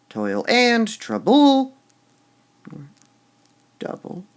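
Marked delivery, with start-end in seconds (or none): 0.1-1.8 toil AND trouBLE, both high and loud; slow, overdone backwards stress on toil and trouble; stress on wrong syllables. Shown as a demonstration. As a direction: wrongly punch "and" and the end of trouble. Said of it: "You wouldn't say".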